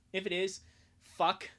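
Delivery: ordinary talk, nothing marked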